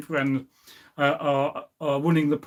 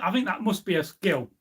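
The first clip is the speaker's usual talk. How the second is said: ordinary delivery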